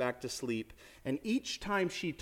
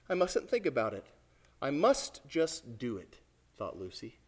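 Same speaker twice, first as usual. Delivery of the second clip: normal talking voice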